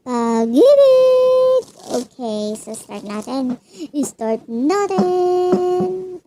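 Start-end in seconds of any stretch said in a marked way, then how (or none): 0.1-1.6 dramatic sing-song. Acted. Dramatic reveal of love reading. 3.9-6.2 sing-song. Acted. Sing-song start of reading.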